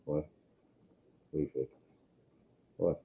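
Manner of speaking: normal talking voice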